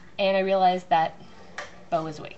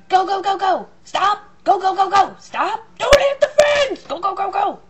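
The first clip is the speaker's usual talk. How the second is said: much higher and louder frantic yelling; shouting directions; 12 semitones higher, 10 dB louder than usual. Retold or imitated. Husband guiding wife backing the car, shouting. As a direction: bark orders at the driver in a panic. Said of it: "he's shouting".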